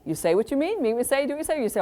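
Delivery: ordinary talk, nothing marked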